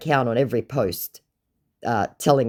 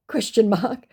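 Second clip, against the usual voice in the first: ordinary talk, nothing marked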